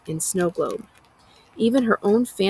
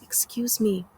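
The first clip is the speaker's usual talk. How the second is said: softer, timid excuse me; small voice; 3 semitones higher, 5 dB quieter than usual. A narrator's reading. Outcast woman timidly calling to the narrator, small. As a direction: ask shyly, barely heard. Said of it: "I hear a small".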